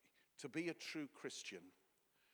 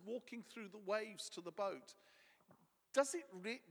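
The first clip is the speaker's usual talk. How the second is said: ordinary talk, nothing marked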